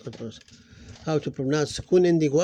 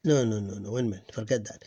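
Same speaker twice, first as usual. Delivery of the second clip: ordinary talk, nothing marked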